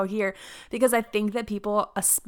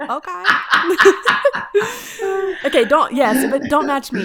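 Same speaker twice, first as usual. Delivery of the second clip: laughing; 8 semitones higher, 10 dB louder than usual. Real, not acted. Laughing at being called soothing.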